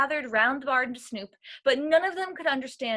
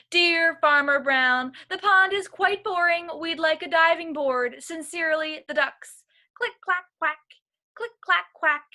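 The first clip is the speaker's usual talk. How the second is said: higher, chirpy duck note and quacking refrain; ducks' cheeky letter; 6 semitones higher, 4 dB louder than usual. A narrator's reading. Ducks asking Farmer Brown for a diving board, chirpy and high. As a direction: play the ducks, pleased with themselves.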